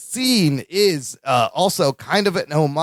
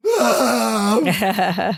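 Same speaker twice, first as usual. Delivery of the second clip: a strained, higher cry from offstage; mock anguished wail; 5 semitones higher than usual. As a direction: let out a pained, hammed-up wail. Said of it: "you hear Balky go".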